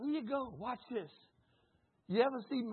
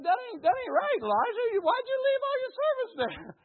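indignant protest; 13 semitones higher, 5 dB louder than usual. Retold or imitated. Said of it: "I was like".

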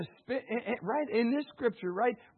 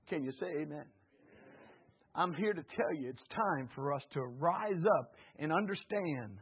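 normal talking voice

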